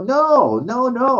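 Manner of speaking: high-pitched retold denial; high, defensive no; protesting innocence. Retelling his denial to police.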